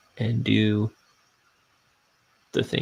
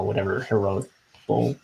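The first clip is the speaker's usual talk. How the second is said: normal talking voice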